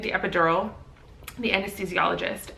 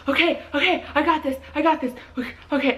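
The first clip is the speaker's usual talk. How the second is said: high-pitched, repetitive fluster; mock nervous panic; 8 semitones higher, faster than usual. Partner getting her to the hospital, mock flustered. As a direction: imitate someone talking himself through panic. Imitating Steve frantic before the drive.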